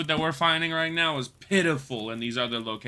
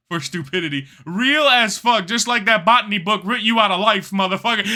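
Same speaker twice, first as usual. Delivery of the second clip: ordinary talk, nothing marked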